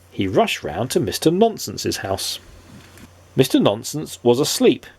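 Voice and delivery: plain reading voice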